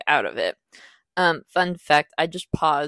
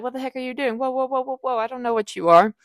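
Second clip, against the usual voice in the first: high, rising freak-out, louder at the end; mock panic, pitched way up; panicked; 7 semitones higher, 5 dB louder, faster than usual. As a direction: retell a panicked scream of alarm. Retold panic when dragged underwater.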